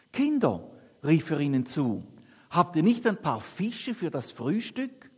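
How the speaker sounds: lively, lightly acted Bible reading to the congregation; scripture reading. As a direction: read the Gospel aloud with the quotes lightly voiced. Reads Bible passage aloud. (lebhafte Bibellesung)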